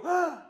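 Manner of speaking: quick mock gasp of surprise; startled yelp. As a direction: jump as if caught off guard. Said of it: "And you'd go".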